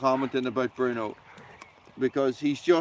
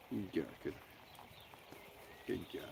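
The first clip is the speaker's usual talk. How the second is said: ordinary talk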